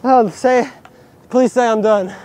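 panting between words; breathless after skating; out of breath. Real, not acted. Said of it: "panting very heavily".

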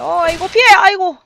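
high, loud panicked cry; alarmed shout. Real, not acted. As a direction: yelp while dodging. Dodging enemy attack.

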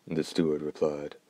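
usual speaking voice

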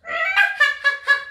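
high shrieking witch cackle; witch's cackle. Shown as a demonstration. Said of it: "Can you do a witch's cackle?".

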